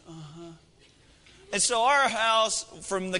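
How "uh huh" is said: put-on small child's murmur. Comforted child answers dad.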